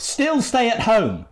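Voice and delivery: "Still stay at home", stretched out with firm insistence; insistent prompting. Acted. Adviser feeds the correct line.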